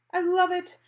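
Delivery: high, loud thrilled cry; delighted shout. Acted. Customer shouts her delight at the slanted bob, high and loud. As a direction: shout your joy at the new haircut. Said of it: "shouted the woman".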